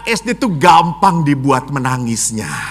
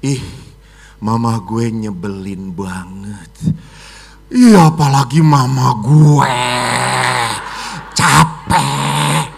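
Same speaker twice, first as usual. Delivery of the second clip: put-on 1 teen complaining; 6 semitones lower, slower than usual.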